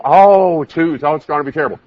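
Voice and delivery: mock dread. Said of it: "some people".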